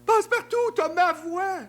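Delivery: shocked to hear own voice elsewhere; indignant surprise. Acted. Passe-Montagne protests to Passe-Partout, high outraged. Voice swap comedy scene.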